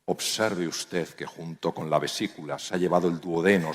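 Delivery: a stiff professor's frosty reproach; cold formal put-down. Shown as a demonstration. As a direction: talk down to a junior surgeon coldly. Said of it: "en lugar de hablarte así".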